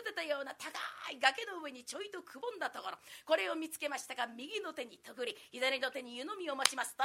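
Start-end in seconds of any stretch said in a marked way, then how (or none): none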